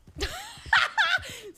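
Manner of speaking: loud, high laugh; burst of laughter. Real, not acted. Streamer laughing at a bad voice attempt, loud and high. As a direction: crack up at your own attempt.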